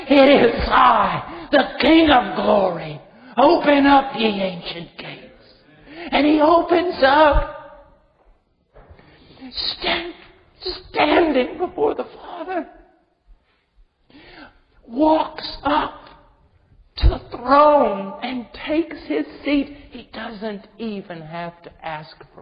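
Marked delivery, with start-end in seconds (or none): 0.1-4.2 loud commanding call. Acted. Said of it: "the mighty Christ yells back". 4.2-19.7 loud, impassioned sermon climax; full-force sermon climax; fiery preaching. Real, not acted.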